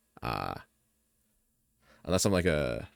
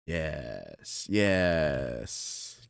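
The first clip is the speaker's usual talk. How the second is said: sly villain purring yes; evil villain voice. Acted. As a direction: savour it like a schemer. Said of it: "Cackles evilly".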